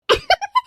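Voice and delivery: high sharp laugh; a quick mocking cackle; burst of laughter. Real, not acted. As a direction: laugh at her fear. Husband laughs at her.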